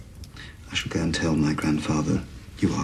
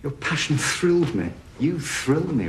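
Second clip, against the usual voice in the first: excited flattery; 11 semitones higher than usual. Acted. Suitor flattering Rose.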